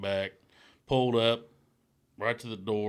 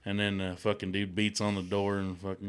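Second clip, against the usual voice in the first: ordinary talk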